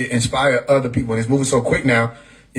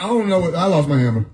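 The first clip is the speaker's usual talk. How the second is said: ordinary delivery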